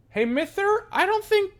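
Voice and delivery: put-on a little kid complaining he won't get paid; high kid voice. The courier kid protesting to the guard in a high whine.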